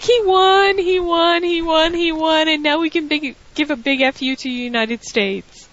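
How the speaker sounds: high-pitched gleeful chant, gradually coming down; mock giddy squeal of victory; imitating overjoyed Lana. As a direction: gush in a high voice, beside yourself with joy. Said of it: "She's like".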